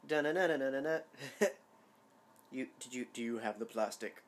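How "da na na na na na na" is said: glitching robot. Retold or imitated. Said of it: "play one malfunctioning robot".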